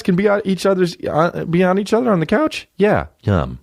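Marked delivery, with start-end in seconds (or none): none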